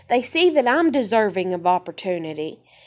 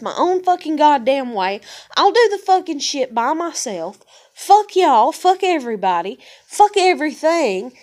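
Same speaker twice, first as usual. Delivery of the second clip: louder, retold defiance thrown at mom and dad; defiant retold outburst; 4 semitones higher, 5 dB louder than usual. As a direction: spit it out like you're done with everyone.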